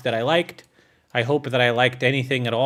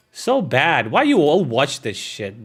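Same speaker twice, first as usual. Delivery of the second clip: ordinary talk, nothing marked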